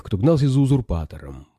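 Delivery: usual speaking voice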